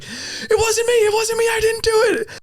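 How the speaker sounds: very high-pitched, frantic squeal of innocence; panicked mock pleading. College kid pleading with the giant dude, panicked mock squeal. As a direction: squeal it like a hammed-up terrified kid. Retold plea to the attacker.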